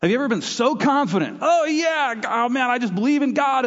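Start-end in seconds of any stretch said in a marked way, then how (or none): 1.4-3.7 much higher, gushing overconfidence; mock eager believer. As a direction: play up a naive, gung-ho believer. Mocking overconfident young believer.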